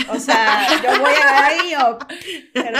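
loud, laughing boast about going; cracking up while promising to tag along; laughing excitedly. Real, not acted. Laughing loudly while telling friends her plan.

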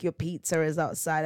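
ordinary delivery

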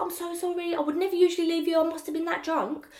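high, pleading apology; mock apologetic. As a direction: beg for forgiveness in a high voice. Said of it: "I said, I was like".

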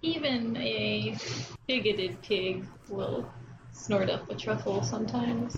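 slow, low, grave reading of a proverb; mock solemn proverb. Reading a quote to the others, slow and solemn. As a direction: intone it like ancient wisdom over music.

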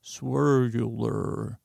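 "Swirlular" drawn way out through a squinched mouth; slow, squinched-up "Swirlular". Shown as a demonstration. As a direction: say "Swirlular" very slowly with lips squeezed close. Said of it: "I gotta squinch my mouth closer".

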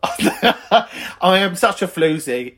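slow, giggly self-mockery; laughing. Real, not acted. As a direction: laugh while admitting it. Laughing at own flirtiness.

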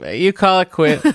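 high, teasing mock outrage; mock indignant. Real, not acted. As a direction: pretend to be offended, playfully.

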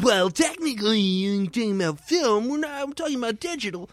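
high, fussy correcting film geek; film nerd voice. Acted. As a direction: nitpick like a pedantic tech nerd. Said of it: "That's your film nerd voice".

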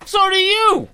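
indignant retort. Real, not acted. Friend disputing the shoes in a height contest, indignant. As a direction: throw it back high in protest.

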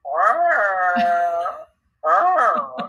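long drawn-out mock meow; taunting cat noise. Cat taunting the narrator while stroked, drawn-out smug yowl. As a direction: imitate a cat moaning with pleasure. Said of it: "giving me cat side eye, going".

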